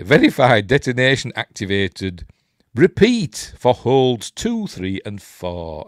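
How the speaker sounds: loud, deliberate announcement; over-emphatic manual reading; instruction read-out. Acted. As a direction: punch out each step like an official order. Declaims odd on-screen instructions.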